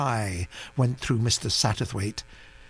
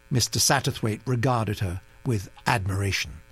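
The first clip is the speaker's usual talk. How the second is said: plain reading voice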